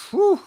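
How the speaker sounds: high, loud puff of exasperation; exasperated whew. Real, not acted. Host at a loss over the episode, exasperated whew. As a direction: blow out a high, weary whew.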